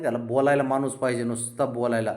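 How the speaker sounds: usual speaking voice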